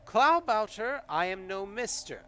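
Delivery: high and loud, cutting in; interrupting creature voice. Acted. Kobold interrupting the player to correct his name. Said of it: "to interrupt you".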